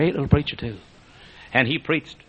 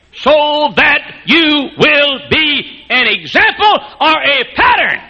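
very high, loud preached charge, slowing at the end; preaching at full force; 6 dB louder, slower than usual. Acted. Paul charging Timothy, preached high and loud.

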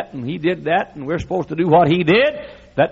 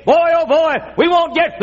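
mock snooty church member, higher and louder; mock snob; 13 semitones higher, 4 dB louder than usual. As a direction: play up the snob's huffy whine. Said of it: "and say".

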